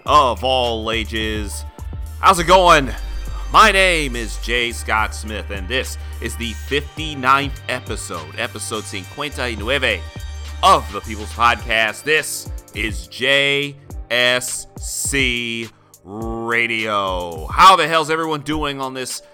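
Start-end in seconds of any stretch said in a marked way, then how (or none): 0.1-12.0 big show-opening announcer. Acted. Podcast host intro. 12.0-17.5 slow drawn-out station ID; radio station ID. Acted. Station ID sign-on.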